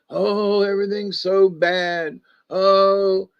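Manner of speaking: mock woe. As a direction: wail lightly in pretend misery. Mimics people who take life too seriously.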